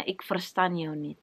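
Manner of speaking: quiet, slow and flat "Ik verstaan jou niet"; quiet matter-of-fact Dutch "I don't understand you"; flat Dutch reply. Retold or imitated. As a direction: say "Ik verstaan jou niet" softly and slowly, barely voiced. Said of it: "langsung dibilangnya".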